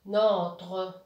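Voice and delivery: slow, firm model reading of NOTRE; blended word. Shown as a demonstration. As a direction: stretch "Notre" slowly, joined up. Reads the blended word after the split.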